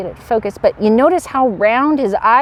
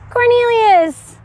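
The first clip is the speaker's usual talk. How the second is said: much higher, slow, stretched-out showman's announcement; grand introduction; 12 semitones higher, 4 dB louder, much slower than usual. Pretend. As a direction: stretch the name out like a ringmaster unveiling a star. Grandly introducing the pet snake.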